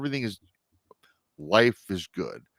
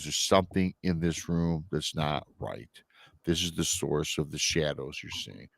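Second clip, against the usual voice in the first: ominous hush; 5 semitones lower, 6 dB quieter than usual. Retold or imitated. Psychic warns ominously.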